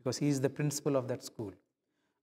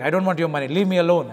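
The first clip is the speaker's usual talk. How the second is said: loud, sharp get away from me; angry brush-off; 2 semitones higher, 10 dB louder, faster than usual. Retold or imitated. Preacher voicing his angry inner retort, loud. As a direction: push him off in a loud snap.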